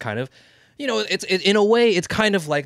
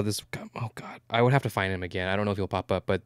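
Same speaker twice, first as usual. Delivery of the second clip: whispering; 4 semitones lower, 6 dB quieter, faster than usual. Real, not acted. Aside to himself.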